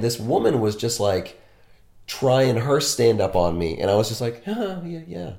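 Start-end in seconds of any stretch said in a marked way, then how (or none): 4.4-5.3 soft, higher polite acknowledgement; half-hearted politeness. Retold or imitated. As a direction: feign interest weakly, high and quiet. Acting out his lukewarm polite response.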